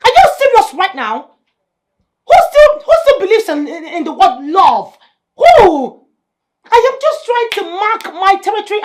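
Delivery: high, loud mocking rant; wife sneering at her friend about love; scornful outburst. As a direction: scoff at her loudly. Wife mocks friend's question.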